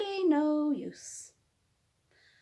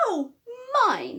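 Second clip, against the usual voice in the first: hollered; 8 semitones higher, 7 dB louder than usual. Acted. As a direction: holler back at the rival. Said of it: "hollered Bruce".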